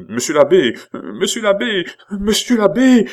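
very high, loud and escalating; panicked cries. A narrator's reading. Pitou retreats from the abbé's whip, panicked cries. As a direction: cry out in mounting alarm.

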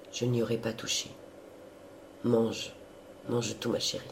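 gently. A narrator's reading. Priest letting his sister eat his bread, gentle and soft. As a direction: let her have it, kindly and quietly. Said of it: "dit l'abbé Mouret doucement".

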